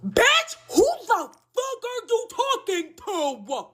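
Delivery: very high, drawn-out disbelief; hammed-up high-pitched indignant comeback; indignant outrage. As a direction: squeal it slowly, utterly affronted. Indignant reaction to being insulted.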